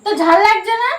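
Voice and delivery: angry taunt. Real, not acted.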